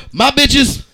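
normal talking voice

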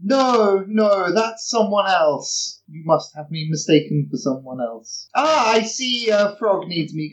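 wriggle out of being recognized; lightly acted flustered denial; evasive denial. Dawn denies who he is, flees.

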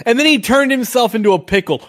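high excited meme voice; meme quote. Retold or imitated. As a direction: quote it like the viral clip. Quoting the meme.

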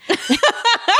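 high giggle; laughing. Real, not acted. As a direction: burst of laughter. Laughing at own story.

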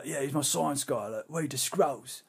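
lightly put-on gruff British; gruff British accent. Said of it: "a weird kind of gruff British accent".

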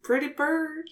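high, soft talking-bird coo; parrot voice. Pretend. Petting the raven, coaxing it.

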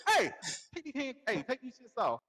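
high-pitched giggly pleading; mock-serious protest, fighting giggles; trying not to laugh. Telling her to take handcuffs off, trying not to laugh. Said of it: "try not to laugh".

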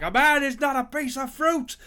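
high, loud, theatrical raging; Willy Loman. Retold or imitated. Podcaster mocking a film's big tragic moment, loud raging. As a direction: rage it out as a hammed-up tragic speech. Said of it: "he's raging against the dying of the light".

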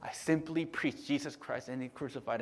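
softly whispered quote. Acted. Preacher voicing Paul's humble words, soft whisper. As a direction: hush it like a confession. Quoting Paul in hushed tone.

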